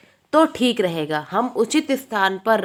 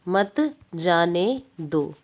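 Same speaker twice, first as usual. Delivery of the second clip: slow word-by-word; 5 semitones lower, 4 dB quieter, much slower than usual. Shown as a demonstration. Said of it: "तो पहला है".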